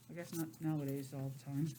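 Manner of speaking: usual speaking voice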